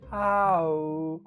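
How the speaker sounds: held "ha" closing into "ow"; rounded-lip noise. Shown as a demonstration. Teacher showing how lip shape changes a noise, rounded glide. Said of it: "change your lips to a different shape".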